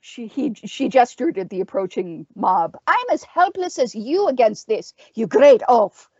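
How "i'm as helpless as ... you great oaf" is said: high, exasperated witch voice; witch's snarl. A narrator's reading. Witch to the fighter before the approaching mob, snarling. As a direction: throw it back at him, pitched up. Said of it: "The witch snarled".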